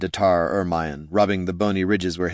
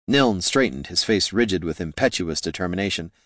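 usual speaking voice

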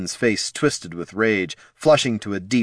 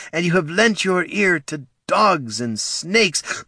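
pleading; 8 semitones higher than usual. A narrator's reading. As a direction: beg him for the tribe. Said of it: "there was pleading in her voice".

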